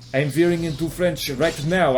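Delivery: put-on German accent gone French; French-tinged accent. Shown as a demonstration. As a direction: slip further into a mock French accent.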